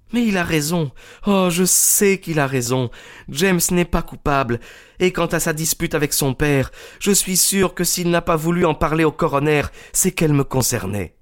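put-on high, earnest young woman's voice; high, insistent young woman's voice; young Miss Turner. A narrator's reading. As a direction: plead passionately for the man she loves.

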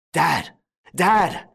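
high, urgent cry for father; urgent calling out. A narrator's reading. Son calls father to injured mother.

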